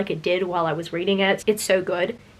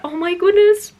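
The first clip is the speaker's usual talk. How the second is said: much higher, louder squeal of adoration; excited squeal; 10 semitones higher, 3 dB louder than usual. Real, not acted. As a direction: squeal over your favourites. Seeing favourite characters' art.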